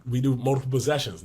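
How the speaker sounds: ordinary talk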